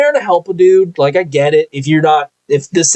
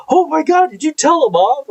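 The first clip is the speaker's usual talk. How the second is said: imitated eager gossip, much higher; mock gasp; 12 semitones higher than usual. Emily asking excitedly if he told the supervisor off. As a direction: gasp it out like juicy news.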